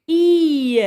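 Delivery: "I" pronounced loudly as the yod sound; emphasized sound. Shown as a demonstration. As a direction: punch the "I" sound out loud. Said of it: "se prononce". ("I" prononcé fort, bien appuyé)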